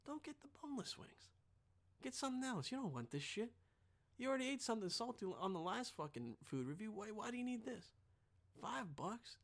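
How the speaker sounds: soft whisper of a warning inner voice. Pretend. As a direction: hush it like a conscience nagging. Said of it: "Something on the back of my mind was like".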